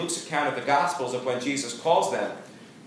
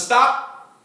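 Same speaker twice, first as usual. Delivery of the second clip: sharp high call, much louder; commanding shout; 8 semitones higher, 5 dB louder than usual. Retold or imitated. Angel stopping Abraham from the sacrifice, sudden loud shout. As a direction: cry out to halt the knife.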